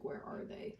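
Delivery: hushed, breathless search under her breath; whispering to herself. Retold or imitated. Crafter searching her stash, whispering to herself. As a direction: whisper it as if hunting for lost cartridges. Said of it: "'cause I'm like".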